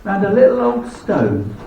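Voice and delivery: measured, heartfelt recitation over music; poem recitation. Acted. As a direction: tell it like an old sad ballad.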